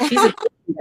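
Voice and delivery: high, loud hype for a queen; big cheer for her friend; excited praise. Real, not acted. Hyping up the guest, high excited praise.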